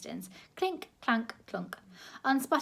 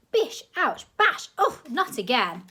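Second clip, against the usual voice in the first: much higher, louder yelps at each blow; pained yelps; 6 semitones higher, 8 dB louder than usual. A narrator's reading. People in the crowd struck by the ear trumpet, loud high yelping. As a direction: yelp as if struck again.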